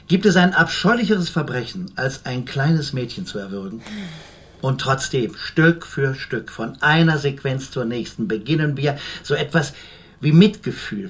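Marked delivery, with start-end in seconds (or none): none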